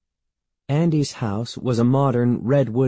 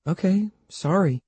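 higher-pitched, hasty apology; quick reply; 9 semitones higher than usual. A narrator's reading. Andy apologising to an impatient Evan, quick reply. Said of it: "Andy replied quickly".

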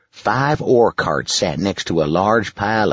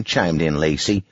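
ordinary delivery

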